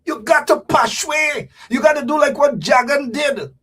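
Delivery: high, forceful exhortation; rousing shout. Acted. Urging the crowd to act like Jagan, shouted.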